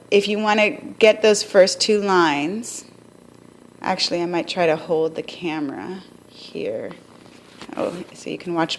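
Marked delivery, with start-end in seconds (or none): none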